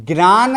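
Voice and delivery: ज्ञान said as "gnaan", hard g plus n; mispronounced ज्ञान. Shown as a demonstration. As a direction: say ज्ञान with a gn onset, as a questioning test. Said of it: "ज्ञ का जो उच्चारण करते हैं वो गलत है". (ज्ञान को "ग्नान" बोलना)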